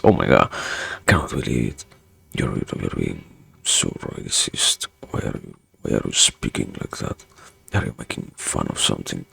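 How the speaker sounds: low, hushed, half-whispered mock outrage; mock scandalised accuser. Voices an accuser reacting to accent.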